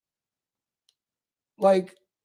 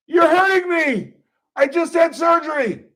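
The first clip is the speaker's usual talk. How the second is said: higher, loud whine of protest; whiny arrestee; 10 semitones higher, 3 dB louder than usual. Retold or imitated. Arrestee whining at the cop while being cuffed. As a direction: complain like a hurt suspect. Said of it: "Number eight".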